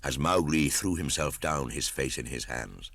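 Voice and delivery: plain reading voice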